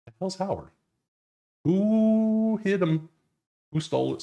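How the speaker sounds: high, drawn-out mock alarm at a missing team; mock outrage. To viewers while searching the sheet, hammed-up high cry. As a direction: camp it up, like someone ran off with Howard.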